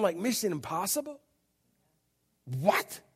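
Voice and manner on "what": usual speaking voice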